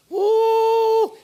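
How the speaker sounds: high, loud excited whoop. Real, not acted. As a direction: let out a loud, high, delighted woo. Pastor excited about his cooking.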